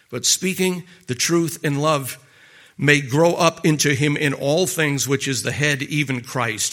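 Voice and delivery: preacher reading Ephesians to the congregation, slow and solemn; scripture reading. Acted. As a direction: read the verse with weight. Reads scripture aloud.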